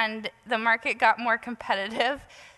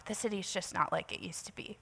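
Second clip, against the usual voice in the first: whispered, barely voiced; hushed whisper; 4 semitones lower, 12 dB quieter, faster than usual. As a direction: drop to a confiding whisper. Officer consoling upset woman.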